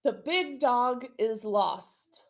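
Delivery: slow, measured model sentence; model sentence for echoing. Shown as a demonstration. Teacher gives the class a sentence to echo, slowly and clearly. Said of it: "echo this sentence".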